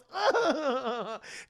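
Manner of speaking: high crybaby bawling; mock sobbing. Son crying on dad's shoulder, mock bawling. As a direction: wail in an imitation of sobs. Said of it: "cries into his dad's shoulder".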